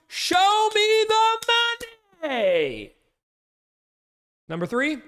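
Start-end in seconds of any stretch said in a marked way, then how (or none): 0.1-2.9 high, drawn-out shout of the line; movie quote shout. Retold or imitated. Streamer reading list, hammed-up high shout. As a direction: belt it like the film.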